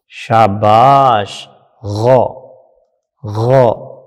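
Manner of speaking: drawn-out غو with a slow شاباش; slow sound demo. Shown as a demonstration. Teacher modelling غو for children to repeat. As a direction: model the sound غو slowly for the pupils. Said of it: "غائن زبر کی آواز کیا آئے گی".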